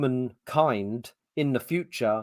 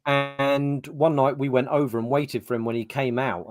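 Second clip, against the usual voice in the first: ordinary talk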